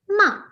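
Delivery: "ma" starting high and dropping sharply; fourth tone, falling. Shown as a demonstration. Teacher shows student the fourth tone, falling "ma". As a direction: drop the pitch fast on a short "ma". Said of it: "speak in all the four tones".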